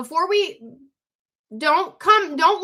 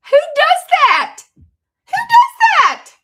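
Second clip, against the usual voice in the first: very high, loud, slow squeal of disbelief; amazed squeal; 11 dB louder, slower than usual. Real, not acted. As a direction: shriek it in delighted shock. Amazed at paying off card.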